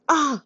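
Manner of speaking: sharp high yelp of pain. A narrator's reading. Chukwu stung by a cactus, sharp high yelp. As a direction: yelp as the thorn cuts. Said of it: "Chukwu hissed".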